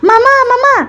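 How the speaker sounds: put-on high, loud child yelling for mom; calling out. Boy yelling to his mother in the kitchen, high and loud. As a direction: shout for mom across the house. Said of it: "βάζει τις φωνές".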